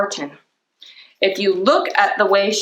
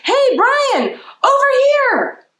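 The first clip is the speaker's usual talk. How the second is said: high, slow call across the room; 4 dB louder, much slower than usual. A narrator's reading. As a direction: wave him over with a long high call. Justin calling Brian over.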